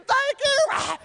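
very high, loud, wailing thanks; mock crying. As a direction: wail it as a blubbering big kid. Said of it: "He was crying".

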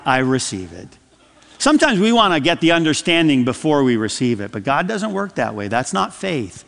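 ordinary talk

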